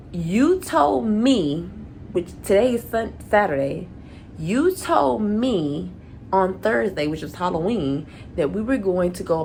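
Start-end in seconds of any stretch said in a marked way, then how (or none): 0.1-1.7 YOU TOLD ME, each word drawn out slowly; slow, deliberate accusation, word by word; indignant reproach. Retold or imitated. Angry recounting of broken promise. 4.4-5.9 YOU TOLD ME, each word drawn out slowly; slow, deliberate accusation, word by word; indignant reproach. Retold or imitated. Repeats the accusation for emphasis.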